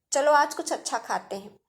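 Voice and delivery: normal talking voice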